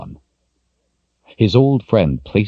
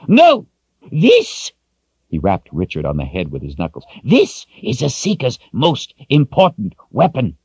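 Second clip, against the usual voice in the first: emphatic shout; 8 semitones higher than usual. Acted. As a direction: silence her and drive the point home. Zed cuts her off.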